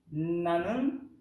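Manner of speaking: ordinary talk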